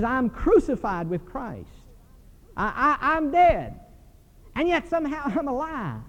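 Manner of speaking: preaching. Acted. Preaching Paul's words.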